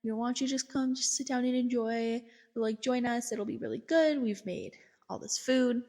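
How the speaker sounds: high, lilting imitation of coaxing hosts; coaxing invitation. Family urges the therapist to stay for dinner, coaxing. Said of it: "try to get me to, like, stay for dinner".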